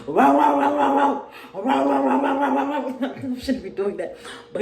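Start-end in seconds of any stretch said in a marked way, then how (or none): 0.1-3.1 high loud rah rah chant mocking her anger; mock gorilla rage. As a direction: roar out rah rah like a raging gorilla. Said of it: "He said".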